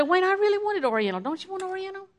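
high-pitched, pleading wheedle; whiny coaxing wife. Retold or imitated. Wife pleading with husband for Oriental food, high whiny coax.

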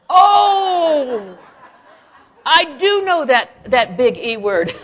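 loud, high drawn-out "oh", then slow emphatic words; hammed-up dawning dread, laughing along; exaggerated recognition. Comic reaction to dreaded word exercise.

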